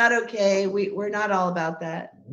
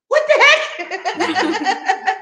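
high, slow, drawn-out mock bafflement; baffled what the heck; 7 dB louder, much slower than usual. Mom acting out finding the chalk door, high drawn-out bafflement. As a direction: stretch it out, high and puzzled.

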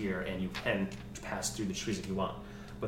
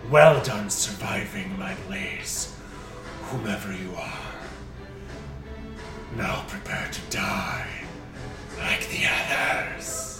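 slow, louder menacing dragon; 8 dB louder, slower than usual. Acted. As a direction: taunt like a looming dragon. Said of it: "the dragon's booming voice speaks".

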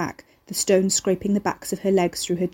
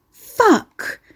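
much higher, louder burst of dismay; a sudden startled swear, higher and louder; shocked curse; 13 semitones higher, 5 dB louder than usual. A narrator's reading. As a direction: blurt it out as the money vanishes. Her fiver blows into well.

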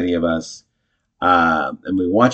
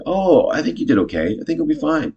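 high, placating reassurance; acted "it's fine" voice, lightly pitched up; mock reassurance; 8 semitones higher than usual. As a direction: pitch it up high to smooth things over. Acted reassurance after a blunder.

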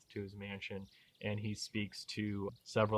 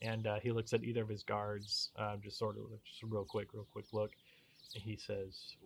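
ordinary delivery

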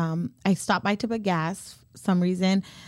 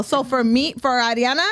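usual speaking voice

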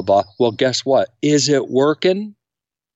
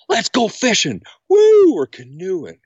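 put-on excited little kid; 9 semitones higher, 4 dB louder than usual. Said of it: "They turn into little kids again".

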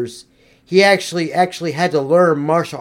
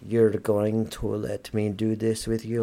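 much lower, far softer voice of Russian Ivan; 9 semitones lower, 13 dB quieter than usual. Pretend. Ivan answers the American patriot, low and quiet. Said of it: "Ivan, come here".

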